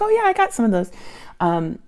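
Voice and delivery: mocking high-pitched Julie. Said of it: "So that's my Julie voice".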